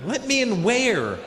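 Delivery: high, slow, drawn-out disbelief; incredulous. Acted. Passenger questioning his friends in the car, incredulous. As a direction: draw the question out in mock bafflement. Baffled reply to vague directions.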